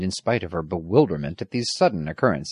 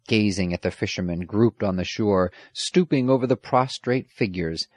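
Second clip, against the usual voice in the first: usual speaking voice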